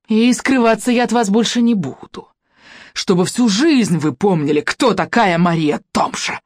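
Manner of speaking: triumphant snarl. A narrator's reading. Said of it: "осклабилась".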